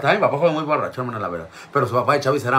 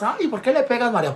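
onlookers protesting; 11 semitones higher than usual. Retold or imitated. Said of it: "Y todos".

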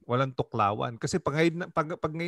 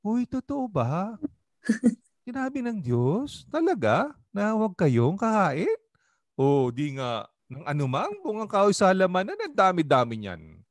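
much higher, feigned-surprise "Uy, totoo ba?" and "Talaga?"; sly friendly serpent; 6 semitones higher than usual. Shown as a demonstration. Pastor showing how the serpent really approached Eve, slyly. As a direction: act amazed and concerned to bait her. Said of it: "Ang approach yan".

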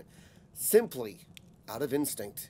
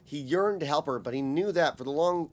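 ordinary delivery

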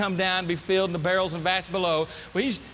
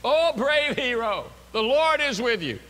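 raised, drawn-out mock fanfare; 5 semitones higher, 4 dB louder than usual. Messenger greeting a hiding Gideon, mocking. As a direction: hail him with overblown praise. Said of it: "perhaps he's mocking".

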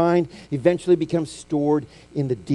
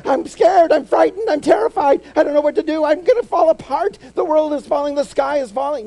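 high, loud panicked shouting, hammed-up; mock panic; 12 semitones higher, 7 dB louder than usual. Preacher mocking a panicked believer, hammed-up terrified shout. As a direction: wail it like the sky is truly falling.

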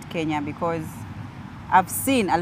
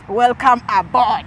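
slow mock cabin greeting; flight attendant voice; 4 semitones higher, 6 dB louder, much slower than usual. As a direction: greet passengers like crew. Mimics flight announcement.

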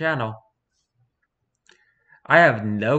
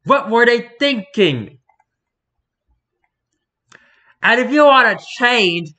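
excited rant; 9 semitones higher, 6 dB louder than usual. Acted. Ranting about game devs.

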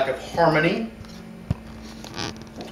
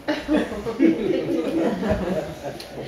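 real laughter; 5 semitones higher than usual. Real, not acted. Breaks up mid reading.

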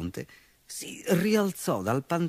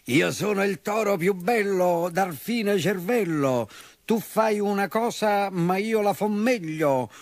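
boastful shout; 6 semitones higher than usual. A narrator's reading. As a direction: crow it out like a vain bull. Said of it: "gridò forte".

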